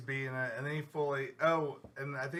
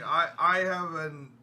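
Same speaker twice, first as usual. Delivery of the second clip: louder, slowed mock yell; loud quoted outburst, drawn out; retold yell; 3 semitones higher, 8 dB louder, slower than usual. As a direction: call it out loudly, imitating him. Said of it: "he yells out".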